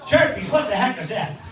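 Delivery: normal talking voice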